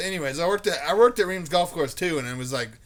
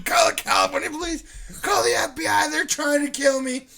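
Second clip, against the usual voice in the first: high, raised voice, repeated plea; retold frantic shouting; 11 semitones higher, 4 dB louder than usual. Stranger taking off, repeating his plea, frantic shouting. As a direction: cry out like a man running away. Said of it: "he just kept repeating".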